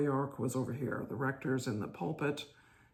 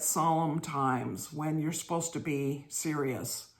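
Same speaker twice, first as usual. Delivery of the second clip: ordinary delivery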